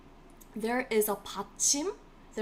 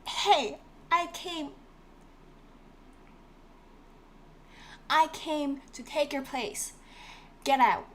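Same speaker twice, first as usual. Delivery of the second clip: higher, playful voice of a bossy consonant; pushy intruder; 4 semitones higher than usual. Pretend. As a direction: barge in and mock-order someone out.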